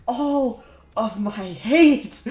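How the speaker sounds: drawn-out hammed-up cry, high and loud; mock dramatic yell. Quoting a commenter's line about Jaco, mock yell. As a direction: howl it slowly like a wounded villain. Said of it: "they just quoted it with, like".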